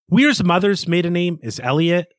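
usual speaking voice

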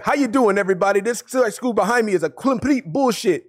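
put-on high-pitched, botched German accent; bad German accent. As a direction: attempt a German vlogger's accent that drifts toward Jamaican. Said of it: "that was my best German accent".

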